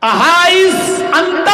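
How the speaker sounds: slow, high call after a man walking away; loud call. Retold or imitated. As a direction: stretch it out to make him turn. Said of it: "پھر کہا، آواز دی". (اونچی آواز میں کھینچ کر پکارنا)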